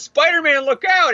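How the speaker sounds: high, urgent mock cry of warning; Mary Jane. Wife warns hero of the villain, hammed-up urgent cry. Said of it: "she says".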